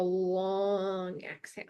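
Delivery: "long" stretched out at length; drawn-out LONG. Shown as a demonstration. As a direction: draw out the word "long" like the breath.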